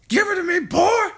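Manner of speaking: very loud, high hag yell; Grandma yelling. A narrator's reading. Grandma yelling at George, loud high crone voice. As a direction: scream at the boy like a witch. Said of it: "yelled Grandma".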